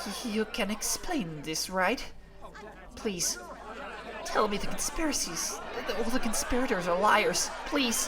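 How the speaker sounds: high, pleading, voice wavering; desperate tearful plea. Acted. Character begging the mentor to say it isn't true. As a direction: beg them, on the edge of tears.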